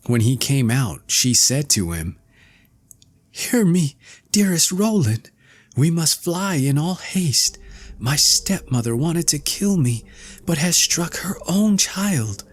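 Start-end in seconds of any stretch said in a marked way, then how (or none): 3.3-12.4 put-on high urgent young girl voice; frightened girl. A narrator's reading. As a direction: warn him in a breathless hurry. Girl urgently warns Roland.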